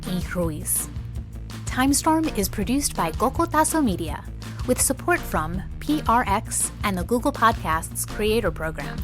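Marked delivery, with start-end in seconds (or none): none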